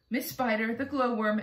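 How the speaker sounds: usual speaking voice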